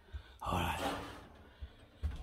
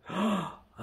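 excited gasp; 7 semitones higher, 7 dB louder than usual. Real, not acted. Finds an even older phone.

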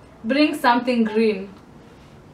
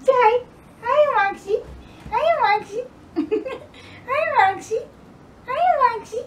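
put-on high baby voice to a dog; pet talk; 12 semitones higher, 5 dB quieter than usual. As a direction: coo at the pet. Greeting pet dog Maxi.

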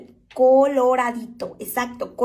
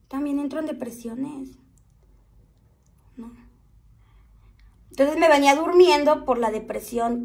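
ordinary talk, nothing marked

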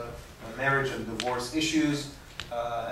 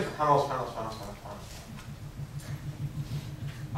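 rattled-off "subhanallah", run together and fading softer; rapid mechanical repetition; 3 semitones lower, 6 dB quieter, much faster than usual. Shown as a demonstration. Teacher mimics mindless repetition of dhikr, hurried and trailing away. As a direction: race through "subhanallah" with only the tongue engaged. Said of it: "don't just say it".

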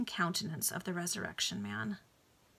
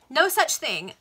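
higher, much louder denial; emphatic denial; 7 semitones higher, 9 dB louder, slower than usual. A narrator's reading. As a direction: slam the no down. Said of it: "restarted line".

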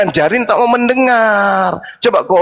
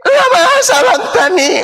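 crying out; 12 semitones higher, slower than usual. Retold or imitated. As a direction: wail it up to heaven. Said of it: "Pendeta-pendeta selalu teriak".

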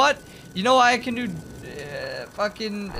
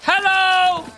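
calling out; 4 semitones higher, 5 dB louder, much slower than usual. Real, not acted. Calling out to team.

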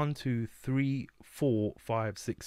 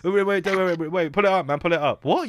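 high, loud pull it up; hyped crowd calling for a rewind; mock rewind call; 7 semitones higher, 6 dB louder than usual. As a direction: shout for the DJ to rewind.

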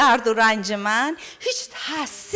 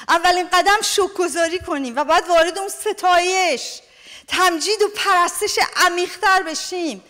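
rising, impassioned sermon voice, higher and fuller; fervent preaching; 5 semitones higher than usual. Acted. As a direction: preach it with growing fire.

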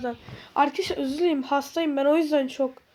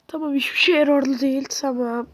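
ordinary talk, nothing marked

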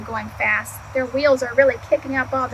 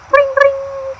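high, louder ring ring like a bell; bike bell imitation; 12 semitones higher, 6 dB louder than usual. Reader ringing Duck's bike bell for kids.

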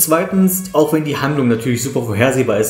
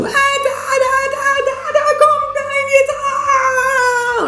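very high, frantic yelling; kids yelling at screen; much slower than usual. Retold or imitated. Children watching Fievel scream at the screen, frantic high yelling. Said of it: "die werden den Bildschirm anschreien".

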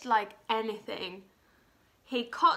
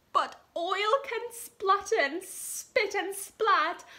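high, trembling frightened cook voice; scared cook; 9 semitones higher, 5 dB louder than usual. Acted. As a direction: squeak it out like a terrified little cook. Cook fears the hot oil.

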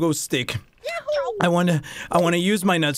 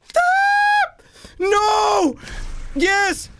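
panicked scream; 14 semitones higher, much slower than usual. Real, not acted. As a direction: scream at the falling character. Big fall in game.

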